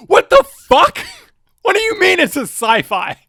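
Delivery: high, loud comic indignation; worked-up mock outrage; exasperated outburst. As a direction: shout it in utter disbelief. Comic rant winding up.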